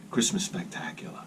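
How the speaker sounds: whispered title. Real, not acted. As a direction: breathe it out voiceless.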